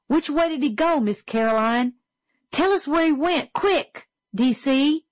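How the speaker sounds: put-on high, urgent boyish voice; eager schoolboy. A narrator's reading. Little Chuck urging the teacher to point out the mouse, eager and high. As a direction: call out quick and keen.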